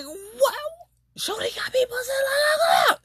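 high, shocked cry of disbelief; astonished high-pitched outcry. Retold or imitated. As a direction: squeal it out in pure shock. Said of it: "And I'm like".

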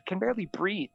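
retold plea, comically put out; mock exasperation. Winded priest complaining to kids as they run, mock exasperation.

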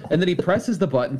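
ordinary talk

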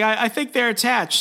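high-pitched flustered stammer; mock nervous pleading. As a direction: act out a flustered suspect explaining. Peter pleading with panicked cops.

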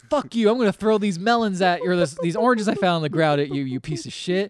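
loud, high acted tirade; mock screaming. Drunk man screaming at woman who refused him, mock screaming. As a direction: act out hurling abuse at someone. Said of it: "screaming at her".

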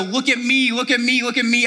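high, loud, showy calling out; look-at-me bragging. Retold or imitated. Preacher mimicking a showoff begging for praise, loudly. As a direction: wave the sign and demand attention. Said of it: "we hold it up high and we go".

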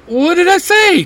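demanding. A narrator's reading. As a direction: insist on an answer, offended. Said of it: "Jace demands".

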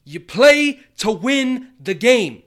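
high, loud, slow coach shout; Herm Edwards impression. Shown as a demonstration. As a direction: bellow it like a fired-up coach. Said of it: "Herm Edwards".

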